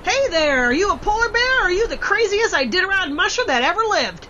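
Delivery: high, delighted hail; hearty amazed welcome; excited greeting. Acted. Greeting the arriving musher.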